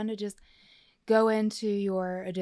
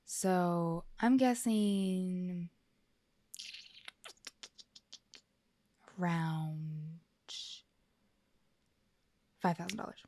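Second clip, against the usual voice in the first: slow suspense; much slower than usual. Pretend. Suspenseful guess pause.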